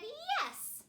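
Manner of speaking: high, slow, thrilled reveal; drawn-out delighted yes; excited. Acted. Storyteller revealing sister's joyful answer, excited.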